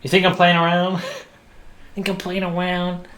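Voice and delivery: high, loud, fast angel threat; badly faked impression, pitched well up and rattled off; angel accent. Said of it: "That's an angel accent".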